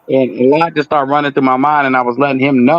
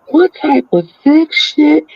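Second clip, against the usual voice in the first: much higher, slow, disgusted disbelief; appalled question; 13 semitones higher, slower than usual. Retold or imitated. Client hearing the mother's part, slow high appalled disbelief. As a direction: stretch it out in shocked disgust.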